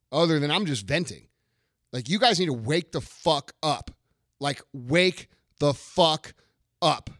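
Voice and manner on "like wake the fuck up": each word dragged out slowly and hit; slow emphatic command. Real, not acted. Demanding that listeners wake up, slow and heavy. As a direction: spell it out word by word.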